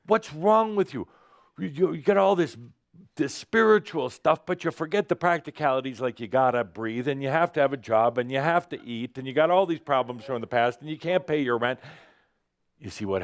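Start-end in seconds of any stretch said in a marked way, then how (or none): 0.1-11.8 higher, scolding mock tirade; human screaming out. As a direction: play the fretting human self, pitched up and nagging. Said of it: "It's the human screaming out".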